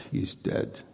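breathy whisper of he's dead; whispered aside. Shown as a demonstration. Preacher whispering the enemy's shrug to the congregation. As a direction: let it slip out barely voiced, as an afterthought. Repeats it under his breath.

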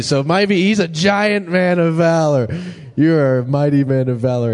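excited affirmation. Real, not acted. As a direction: cheer them on from the pulpit. Hyping up a congregation member.

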